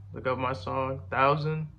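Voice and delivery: ordinary talk, nothing marked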